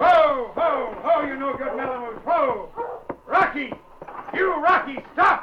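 loud, high calls to halt the team; shouting to the dogs. Acted. Driscoll yells at his Malamutes and Rocky to stop. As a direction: bellow across the snow at the sled dogs.

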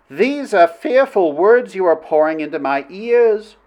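high-pitched princess voice; troubled princess. Acted. Princess answers Flynn's fearful words, troubled. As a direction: protest as a woman being swayed.

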